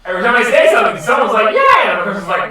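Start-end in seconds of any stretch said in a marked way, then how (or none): 1.5-1.9 high, loud mock cheer; mimicked eager shout. Game master mimics players' replies, high mock shout. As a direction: imitate someone yelling approval.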